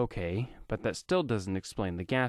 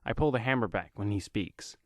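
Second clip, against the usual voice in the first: plain reading voice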